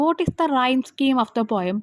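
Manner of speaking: drawn-out reading of the exam question; slow reading aloud. Shown as a demonstration. Teacher reads the question paper to students, slowly. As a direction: read it out slowly so they can follow.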